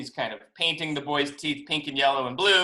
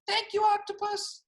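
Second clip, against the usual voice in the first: high, uncertain little thank-you; worried, squeaky thanks. A narrator's reading. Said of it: "the boy's a little worried".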